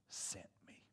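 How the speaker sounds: whispered prayer ending; Jesus finishing his prayer, whispered. Acted. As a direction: breathe it out as a whisper. Quoting Jesus' prayer softly.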